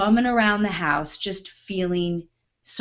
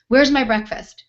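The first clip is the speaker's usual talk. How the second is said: loud, impatient demand; mock the impatient diner; entitled diner barking at the waitress; demanding customer; 4 semitones higher, 6 dB louder than usual. Customer demands her breakfast.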